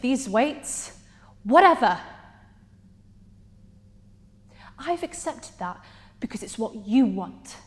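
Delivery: pointed questions, each dismissed after a pause; exasperated confrontation. Acted. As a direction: challenge him, with a burst on the dismissal. Monologue confronting Adrian.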